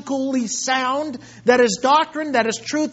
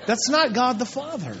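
usual speaking voice

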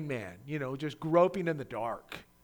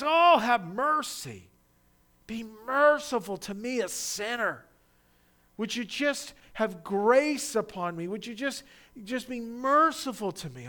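pleading cry; 7 semitones higher, 5 dB louder than usual. Retold or imitated. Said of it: "what is his cry".

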